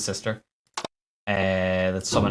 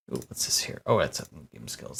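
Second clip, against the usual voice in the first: whispering to self, unvoiced and soft; soft whispered muttering while checking a game; hushed whisper; 9 semitones lower, 7 dB quieter than usual. Real, not acted. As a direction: breathe it under your breath while reading the screen. Muttering while reading game screen.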